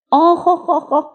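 high "oh-ho-ho-ho" whoop; whooping gasp. A narrator's reading. Littlest fox feeling cider burn his throat, high whooping gasp. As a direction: whoop as the strong drink burns.

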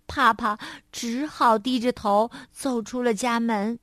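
ordinary delivery

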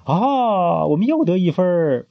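high, joyful shouting cheer; boy cheers as they score; happy cheering. Acted. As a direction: cheer the goal happily. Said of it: "开心地欢呼着".

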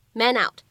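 "man out" joined, n carried onto "out"; natural linking. Shown as a demonstration. Teacher showing learners "man out" linked naturally. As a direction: say "man out" as one word, "ma-nout". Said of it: "可以有一个自然的连读".

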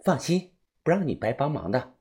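soft voice of a persuading doctor; reassuring doctor. A narrator's reading. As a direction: promise it quietly, as a discreet offer. Doctor's voice in story.

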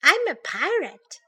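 high-pitched little bear boast; crying out. A narrator's reading. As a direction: announce it like a child at play. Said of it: "cried Baby Bear".

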